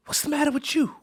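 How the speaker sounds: a hint of a worried old grandma; grandmother's voice. Grandmother questioning him in story.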